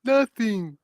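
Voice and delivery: high, drawn-falling mock reply; voice of the ether. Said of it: "and the ether says".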